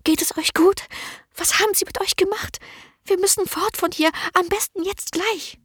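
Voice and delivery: hohe, gehetzte Stimme einer verängstigten jungen Dienerin; drängend, voller Angst, hoch gesprochen; ängstliche Magd. A narrator's reading. Merle voiced high and anxious. (verängstigte junge Dienerin, drängend)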